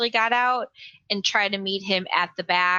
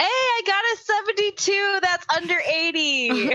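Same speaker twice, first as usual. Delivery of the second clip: excited; 12 semitones higher than usual. Real, not acted. Player pleased with roll.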